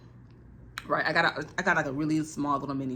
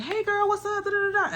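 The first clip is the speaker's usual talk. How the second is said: much higher, bubbly voice for the friends; mock the cheery visitors at 6 AM; high sing-song friend imitation; mock perky greeting. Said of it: "they was just like".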